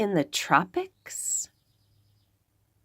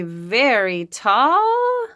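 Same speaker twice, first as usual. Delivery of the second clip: slow stretch; 3 semitones higher, much slower than usual. Acted. Stretched hint.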